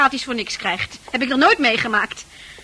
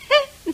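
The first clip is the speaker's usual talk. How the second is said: scornful laugh; 14 semitones higher, 4 dB louder than usual. Acted. Scornful laugh at his question.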